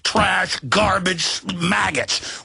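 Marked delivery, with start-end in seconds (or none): none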